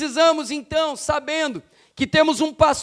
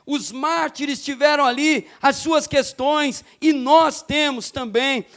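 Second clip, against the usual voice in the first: normal talking voice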